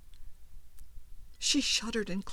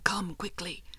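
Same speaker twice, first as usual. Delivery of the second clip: urgent whisper. A narrator's reading. Hiding husband urges wife to hurry.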